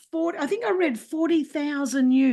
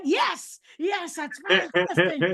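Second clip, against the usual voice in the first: delighted agreement; 3 semitones higher, much slower than usual. Retold or imitated. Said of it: "And I'm like".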